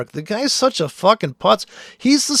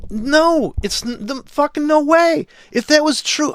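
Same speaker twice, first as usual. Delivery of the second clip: higher, a real frustrated outburst; real exasperation; 8 semitones higher than usual. Real, not acted. Trader loses patience with a silly chart theory, exasperated outburst. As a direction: burst out in real annoyance at the claim. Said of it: "I'm just so pissed".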